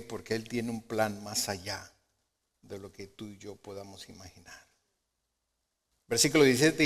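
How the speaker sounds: normal talking voice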